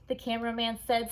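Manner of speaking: ordinary delivery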